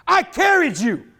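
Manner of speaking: slow, high, loud voice of God; imitating God's reply, slow and lifted. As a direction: boom it out slowly like God. God answers in retold story.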